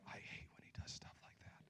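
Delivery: mock whispered grumble to a friend; disciple whispering. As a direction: whisper it behind Jesus's back. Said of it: "Thaddeus looking at Andrew going".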